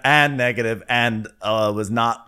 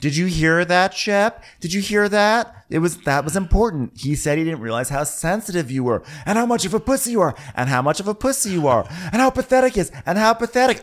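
gleeful mock Leva; 8 semitones higher than usual. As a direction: pile on gleefully. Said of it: "Leva's like".

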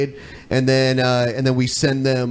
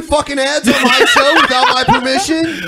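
high, loud mock accusation, laughing; mock outrage; 10 semitones higher, 5 dB louder than usual. Host jokingly accusing co-host of running secret ads, mock outrage. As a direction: pretend to be betrayed by your co-host.

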